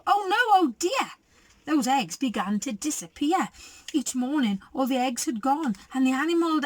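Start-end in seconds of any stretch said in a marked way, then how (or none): 0.1-1.1 mock alarm. Story alarm, eggs disappear.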